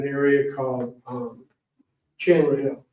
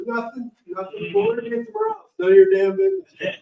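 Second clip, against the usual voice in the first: dismissive grandpa; 14 semitones higher than usual. Retold or imitated. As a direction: wave him away, none of his business. Grandpa brushing off dad.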